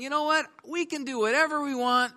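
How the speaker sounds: high, taunting voice of the wicked; wicked boaster. Acted. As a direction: sneer it like a boastful sinner. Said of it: "They're saying".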